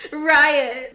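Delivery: high, loud sobbing scream of the name; cracking sob. Acted. Blakely screams Riot's name, sobbing. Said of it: "My voice cracks when a sob racks".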